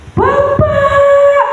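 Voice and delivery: very high call of "Bapak!"; child crying out to his father; joyful cry. Retold or imitated. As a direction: call out to father in sudden joy. Child runs out calling father.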